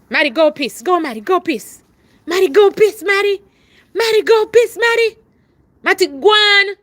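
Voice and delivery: loud, high repeated commands; shouting at the dog to go pee; calling the dog. Real, not acted. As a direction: keep urging the dog out loudly.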